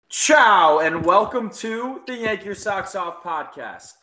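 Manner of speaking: loud, high, drawn-out ciao into a welcome; show-opening shout. Real, not acted. Host greeting listeners to open the podcast, announcer-style. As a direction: launch the show big, stretching the greeting.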